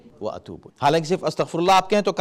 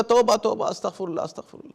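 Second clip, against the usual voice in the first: offhand توبہ توبہ fading to a breathy murmur; mock casual repentance; 5 semitones higher than usual. Preacher mocks the careless sinner: offhand, fading murmur. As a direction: toss off the words, dropping to a whisper. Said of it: "اور کرنے کے بعد کہو".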